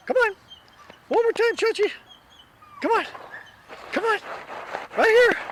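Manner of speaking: high-pitched calls to a dog; coaxing a dog. Real, not acted.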